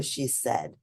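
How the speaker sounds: ordinary delivery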